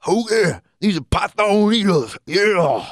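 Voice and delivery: high-pitched mock promo in an odd accent; Vader's weird accent. Wrestler boasting in his promo, imitated weird high accent. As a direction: exaggerate a wrestler's strange, unplaceable accent. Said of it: "he's got, like, a weird accent".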